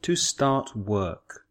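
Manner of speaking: "To start work" said slowly and clearly; drawn-out careful "To start work" for learners; slow classroom model of "To start work"; slow model phrase. Shown as a demonstration.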